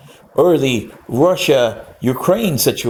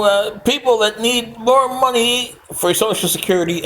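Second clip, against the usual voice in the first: higher, hammed-up campaign promises; higher mock politician nodding yeah; 9 semitones higher than usual. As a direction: tick off the promises like a smug candidate. Mocking candidates' campaign promises.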